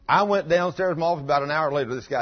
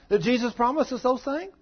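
fiery preaching; 12 semitones higher, 6 dB louder than usual. Acted. Preaching rhetorical questions.

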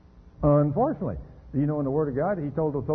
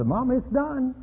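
put-on proud child; 10 semitones higher, slower than usual. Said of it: "Come there and say".

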